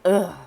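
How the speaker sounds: loud exasperated groan, imitating the king; king's groan. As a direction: groan it out like a cornered man. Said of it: "dude was like".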